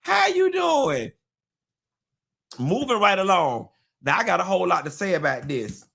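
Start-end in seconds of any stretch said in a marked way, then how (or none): 0.0-1.1 much higher, sugary mock greeting; mock her passive-aggressive sweetness; high, slow fake-friendly hello; fake-sweet Carla. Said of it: "nasty Facebook message from Carla".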